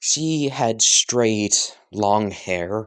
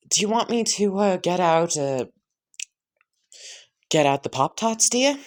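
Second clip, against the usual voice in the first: high, sugary offer; sweet hostess voice; 9 semitones higher than usual. Acted. Mother offers the girl Pop-Tarts in a high sweet voice. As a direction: coo it like a doting auntie.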